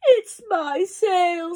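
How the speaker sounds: high, slow bleat of complaint; bleating goat. A narrator's reading. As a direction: voice a goat in distress. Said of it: "who was bleating loudly".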